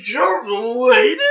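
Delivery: loud, slow, high disbelief; mock shock. Friend mocking an overblown reaction, loud slow high. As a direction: bellow the question in mock disbelief. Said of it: "sort of thing".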